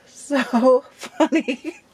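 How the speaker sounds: slow words broken by real laughter; giggling helplessly; laughing. Real, not acted. Laughing recalling a funny conversation.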